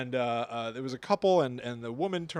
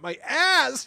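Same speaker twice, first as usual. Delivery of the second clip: high, loud comic outcry; hammed-up cry of pain; mock pained yell; 10 semitones higher, 7 dB louder than usual. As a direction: yell it as if just fallen. Voices woman who fell down.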